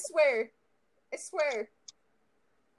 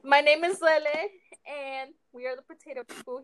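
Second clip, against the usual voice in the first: ordinary talk, nothing marked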